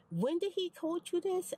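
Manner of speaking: high, sharp mock agent voice; quoting the agent. Retold quote of a phone agent.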